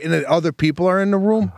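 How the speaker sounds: normal talking voice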